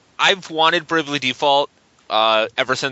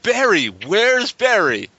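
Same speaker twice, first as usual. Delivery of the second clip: slow, higher, hammy game-dialogue read; bad acting; 7 semitones higher, much slower than usual. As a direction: overact a stiff cutscene line. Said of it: "this acting is terrible".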